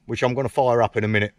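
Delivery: ordinary delivery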